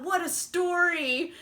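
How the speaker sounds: ordinary talk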